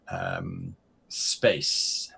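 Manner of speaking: ordinary talk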